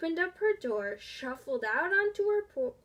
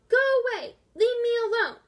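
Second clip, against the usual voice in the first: yelling; 10 semitones higher, 9 dB louder than usual. A narrator's reading. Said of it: "She yelled".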